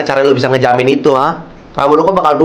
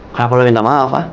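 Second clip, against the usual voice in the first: usual speaking voice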